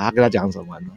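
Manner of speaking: usual speaking voice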